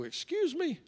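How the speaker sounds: high, soft startled question; quietly baffled, pitch leaping up; taken aback. Retold or imitated. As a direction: ask it softly in disbelief. Retold reaction to next-of-kin news.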